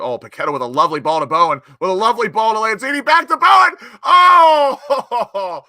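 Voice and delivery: a fan losing it as the move builds; rising excited shout. Real, not acted. Fan calling a slick West Ham move live, building to a shout.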